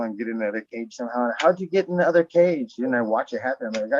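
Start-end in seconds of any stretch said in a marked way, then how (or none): none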